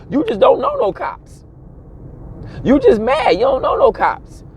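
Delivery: high, squeaky mock of a cop-hater. Mocking the friend who hates cops, high squeaky voice. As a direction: jeer it up high at the doubter.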